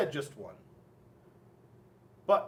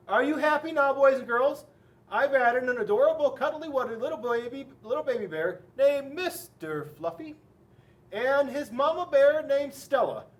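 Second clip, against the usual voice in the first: exasperated author; 6 semitones higher, 6 dB louder than usual. A narrator's reading. Fed-up author giving in to children.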